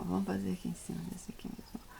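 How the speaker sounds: quick soft whisper to herself; whispered aside. Real, not acted. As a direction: drop to a fast whisper, almost unvoiced. Aside while painting. (cochicho rápido para si mesma)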